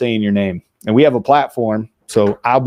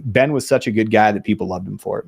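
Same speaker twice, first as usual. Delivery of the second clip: ordinary talk, nothing marked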